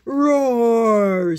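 loud slow monster roar; deep muffled sea monster. Acted. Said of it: "said the sea monster".

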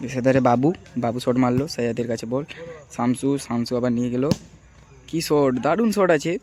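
loud live match commentary, stretching the final cheer; loud sideline commentator rising on the great shot; play-by-play commentary. Real, not acted. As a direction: call the game loudly as it happens.

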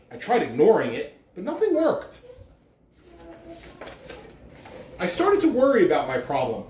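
gentle storybook read-aloud with pauses; reading to children. Acted. Principal reading a picture book to the school, gentle storyteller voice.

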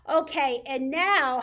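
usual speaking voice

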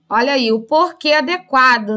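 usual speaking voice

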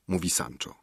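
plain reading voice

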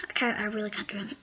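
usual speaking voice

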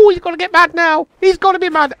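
high-pitched delighted taunt; gleeful gloating. Real, not acted. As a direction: crow over the angry victim. Gloating over killing ghillie boy.

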